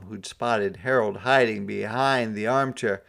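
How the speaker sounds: usual speaking voice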